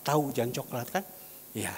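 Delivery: ordinary talk, nothing marked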